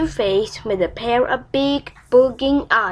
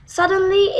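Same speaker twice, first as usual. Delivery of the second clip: ordinary delivery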